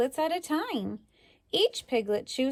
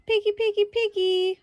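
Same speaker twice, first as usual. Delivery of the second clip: high, louder sing-song call; calling the pig; 10 semitones higher, 5 dB louder than usual. Shown as a demonstration. Narrator calling a pig, high and loud. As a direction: call out to the pig across the yard. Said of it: "don't just stand there and say nothing".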